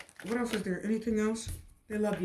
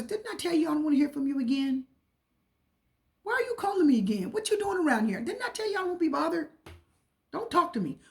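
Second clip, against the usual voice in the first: a rude, higher voice telling off a caller; rude brush-off; 6 semitones higher than usual. Acted. Tarot reader voicing the Page of Swords refusing a caller, rude. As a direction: snap at someone who keeps calling. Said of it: "The Page of Swords is rude".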